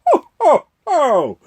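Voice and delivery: booming jolly Santa laugh; Santa's belly laugh. Acted. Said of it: "Santa burst out laughing".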